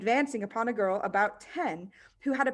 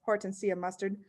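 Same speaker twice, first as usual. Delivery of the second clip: ordinary delivery, nothing marked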